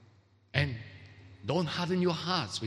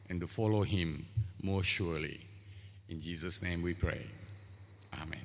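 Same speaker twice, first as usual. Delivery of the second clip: prayer; 5 semitones lower than usual. Real, not acted. Leads an opening prayer.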